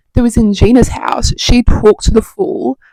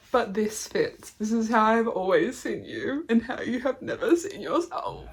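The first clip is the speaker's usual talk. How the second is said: tearful reading; 2 semitones higher, 17 dB quieter than usual. Real, not acted. Reads moving passage while tearful.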